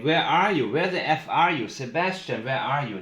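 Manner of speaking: loud, high retold scream of a frantic mother; mock scream. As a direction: call out loud and high as if searching for a missing son. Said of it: "she was screaming".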